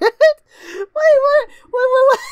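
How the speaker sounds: high, loud, laughing double take; shocked disbelief. Real, not acted. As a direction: cut in, stunned and cracking up. Shocked by what he just read.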